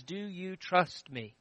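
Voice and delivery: soft and slow, a gentle question; gentle God voice. Acted. God softly asks the widow whether she trusts him. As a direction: ask it softly, slowly, as God to her.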